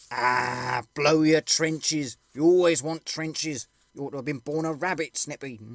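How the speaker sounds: loud, slow scornful soldier; mocking retort. Soldier mocking his comrade Snippy, loud and slow. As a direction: shout him down with contempt.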